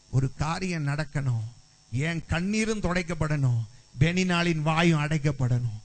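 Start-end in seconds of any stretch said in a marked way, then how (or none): none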